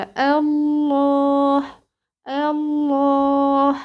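الله chanted very slowly with a full, heavy ل; long-held الله with tafkhim on the ل; heavy lam. Shown as a demonstration. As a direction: recite الله on a steady note, thickening the doubled lam. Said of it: "عليا التغليظ في لفظ الجلالة".

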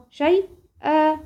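slow شيئا with the final "aa" held after the hamza; compensatory lengthening. Shown as a demonstration. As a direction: break شيئا into "shay-aa", drawn out. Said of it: "هيجيلي مد عوض عن التنوين".

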